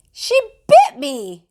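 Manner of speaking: high, loud startled grandma; startled cry. A narrator's reading. Grandma just bitten by Jenny, high startled cry. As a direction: yelp it out in shock. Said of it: "Grandma cried".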